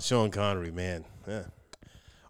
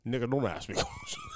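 Sean Connery voice; 6 dB quieter than usual. Acted. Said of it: "it's Sean Connery from the grave".

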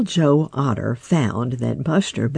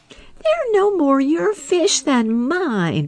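much higher, slow protesting mink voice; slower than usual. Acted. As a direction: object slowly in a high little mink voice. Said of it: "protested Billy Mink".

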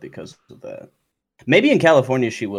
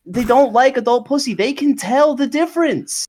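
high-pitched mocking valley-girl voice, much higher; mock girly voice; 14 semitones higher, 5 dB louder than usual. Mocking a pedophile apologist in a high girly voice. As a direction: put on a high airheaded girl voice to mock the take. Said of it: "a little more vocal fry".

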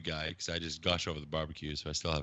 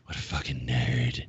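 lower, disgusted wife voice; mock a disgusted wife in a low voice; imitating wife; 7 semitones lower, slower than usual. Wife walks in on his figure collecting, imitated disdain. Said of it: "she's just like".

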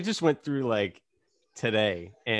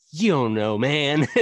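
lightly mock angsty teen, louder; angsty teenager; 2 semitones higher, 6 dB louder, slower than usual. Looking back on his young, dumb self, mock angsty teenager. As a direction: imitate your younger, dumber self protesting. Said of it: "Like an angsty teenager".

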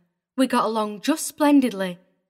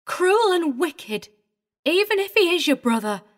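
vehement; 6 semitones higher than usual. A narrator's reading. Governess condemning the father before his brother. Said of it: "suddenly vehement".

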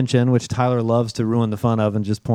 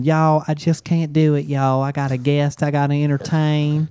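put-on Southern accent; 2 semitones higher than usual. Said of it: "That's your Southern accent".

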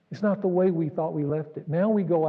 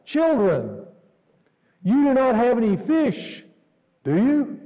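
calling voice from the shore; 6 semitones higher, 5 dB louder, slower than usual. Retold or imitated. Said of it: "And the voice says to them".

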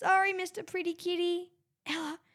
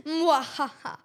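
mwahaha villain laugh; 4 dB louder, slower than usual. Acted.

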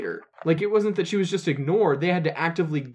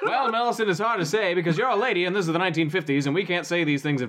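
condescending mid-Atlantic accent, lightly put on; a hint of a fifties mid-Atlantic executive talking down; 3 semitones higher, faster than usual. A Hollywood executive patronizing Millicent, mid-Atlantic accent. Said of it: "Why are you doing a mid-Atlantic accent?".

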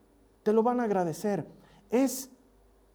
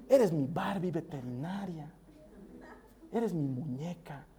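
put-on soft and slow, lowered, like tucking a child in; tender bedtime voice; 3 semitones lower, 9 dB quieter, slower than usual. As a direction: murmur it lovingly to a sleepy daughter. Bedtime words to his daughter. (voz tierna y suave de cuento de buenas noches)

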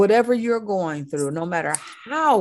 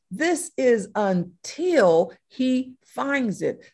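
"This" punched, the rest slow; slowly, insisting on "This is until"; stress on this; 4 semitones higher, slower than usual. Shown as a demonstration. Preacher contrasting wrong "until" with the true one, slowly. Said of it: "this isn't until he gets tired".

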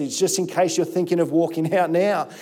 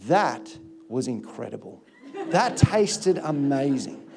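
low, soft, slow relish; savouring; 6 semitones lower, 4 dB quieter, slower than usual. Retold or imitated. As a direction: murmur it to yourself, savouring every bite. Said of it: "what I think to myself".

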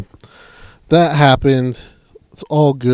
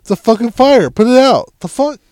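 higher, louder exasperated outburst; exasperated yell; 9 semitones higher, 4 dB louder than usual. Retold or imitated. Him retelling his reply to the fire call, exasperated loud outburst. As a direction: throw up your hands, loud and high. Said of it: "I'm like".

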